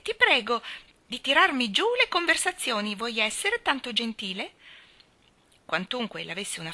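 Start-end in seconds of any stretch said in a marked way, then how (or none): 0.0-4.5 higher-pitched, polite command; ordering, not asking. A narrator's reading. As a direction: make the request an order. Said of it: "dava ordini piuttosto che domandare favori". (un ordine travestito da favore)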